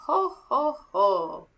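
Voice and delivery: lightly voiced ho ho ho; mock Santa chuckle. Murray shows the boy his Santa laugh, light chuckle. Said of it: "Murray chuckled".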